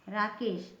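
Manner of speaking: ordinary delivery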